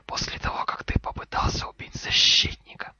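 accusing whisper, building in force; curt hiss. Acted. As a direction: whisper it as a terse reproach. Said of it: "коротко сказал Гарри".

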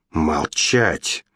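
plain reading voice

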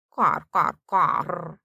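croaked crow call with rolling r; crow caws. A narrator's reading. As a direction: caw like a crow in short bursts. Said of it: "каркает".

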